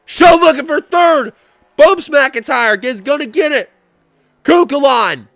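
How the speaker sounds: loud, high excited play-by-play; excited finish call. Real, not acted. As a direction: shout the checkered flag home.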